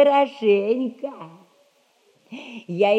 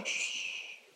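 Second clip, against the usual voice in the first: a sharp unvoiced hush; shushing; 18 dB quieter than usual. Girl hushing her friend in the dark garden, sharp unvoiced hush. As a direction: hush her so nobody hears.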